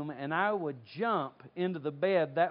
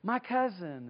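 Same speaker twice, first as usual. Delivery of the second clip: lightly voiced eager acquaintance; bright, chatty comparison; gushing stranger; 5 semitones higher than usual. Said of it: "they say".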